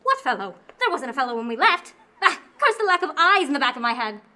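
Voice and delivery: loud alarmed exclamation; worried mother raising her voice; alarmed outburst. Acted. Mother alarmed about daughter.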